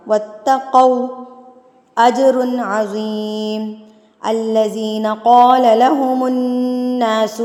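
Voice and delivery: slow, drawn-out melodic tilawat; Quran recitation. Shown as a demonstration. Teacher recites the verse in tilawat style. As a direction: recite with long stretched vowels.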